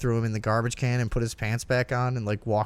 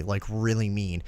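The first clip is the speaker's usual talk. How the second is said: usual speaking voice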